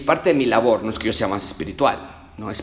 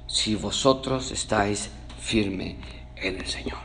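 whispered reading; 3 semitones lower, 6 dB quieter, slower than usual. Real, not acted. Reading verse eight.

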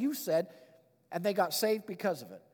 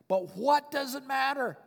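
high, slow, emphatic question; exultant; 6 semitones higher, 3 dB louder, slower than usual. Acted. Preacher relishing Paul's words to the congregation, exultant. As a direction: throw the question out high and defiant.

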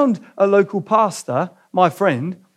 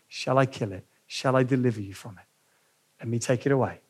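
much softer, lower, tender voice of God; gentle soft offer; 5 semitones lower, 9 dB quieter than usual. Acted. God invites the listener through the angel, soft and tender. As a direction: speak it like a hushed invitation.

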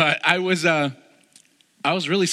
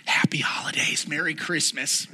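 mock whisper. Mocking a pat answer.

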